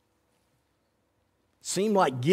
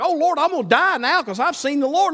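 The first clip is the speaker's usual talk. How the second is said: mock shock; 8 semitones higher, 3 dB louder than usual. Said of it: "he was shocked".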